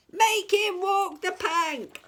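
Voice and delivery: high, loud, slow demand; a pirate crowing over the Troll; gleeful pirate yell. A narrator's reading.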